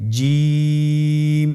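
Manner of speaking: জিম with the vowel held very long, slow and steady; drawn-out letter name. Shown as a demonstration. Teacher has the class repeat the letter after him, drawn out very long. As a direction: stretch জিম out over three alif lengths. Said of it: "আপনারা আমার সাথে বলুন".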